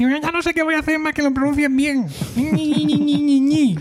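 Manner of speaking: very high, squealed complaint in a put-on voice; mock whining. Host imitates the offended listener giving up, whiny squeal. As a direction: imitate a sulky complainer, high, ending in nonsense whine. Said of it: "eso a él le sienta muy mal".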